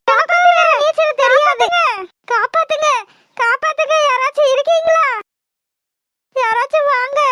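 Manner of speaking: panicked cries for rescue; crying out for help. Acted. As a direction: call desperately to anyone within earshot. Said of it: "ஏன் இப்படி நின்னு கத்திக்கிட்டு இருக்க?".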